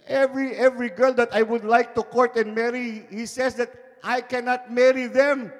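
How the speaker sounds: put-on high whiny boy's complaint; hammed-up tearful son; mock crying boy. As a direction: son whining to his mother. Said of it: "The boy started crying".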